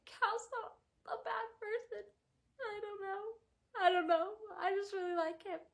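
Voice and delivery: high, wavering mock sob; mock crying. Reader defending Cal, half-joking tearful whimper. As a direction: whimper it like you're about to cry.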